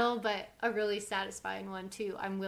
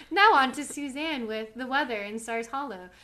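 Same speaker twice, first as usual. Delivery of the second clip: playful mock news anchor handoff; newscaster voice; 2 semitones higher, 4 dB louder than usual. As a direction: toss to the weather like a TV anchor. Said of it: "I sound like a newscaster".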